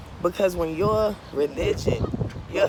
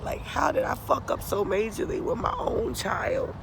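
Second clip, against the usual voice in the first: usual speaking voice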